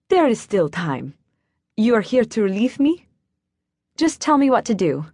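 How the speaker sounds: a hint of a German accent; lightly voiced German grandmother. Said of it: "she spoke with a German accent".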